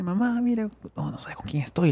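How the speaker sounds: excited whisper. Retold or imitated. Calling mom, excited secret.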